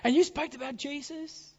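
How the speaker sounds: lightly voiced teenage student; student's voice. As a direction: lift up into a young student. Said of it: "he said".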